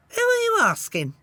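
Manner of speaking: very high, small talking-bird voice; piping bird voice. Acted. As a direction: pipe it up like a tiny bird. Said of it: "an unfamiliar voice piped up".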